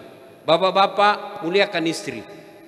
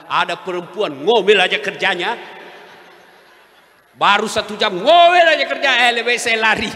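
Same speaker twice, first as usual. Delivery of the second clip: jinn laughing complaint; 2 semitones higher, 8 dB louder than usual. Retold or imitated. Jinn complains of nagging wife.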